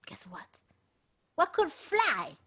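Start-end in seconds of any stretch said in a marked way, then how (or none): 0.1-0.6 hushed suspense whisper. A narrator's reading. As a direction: lean in and whisper the secret. Storyteller builds suspense.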